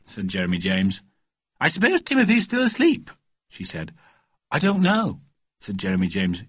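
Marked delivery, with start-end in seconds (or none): 1.6-3.1 very high motherly chat; high-pitched lady of the house; fussy mother. Acted. Mother chatting to boy. 4.5-5.2 put-on high, innocent child's voice; boyish, pitched-up answer; little boy's reply. Boy answers mother.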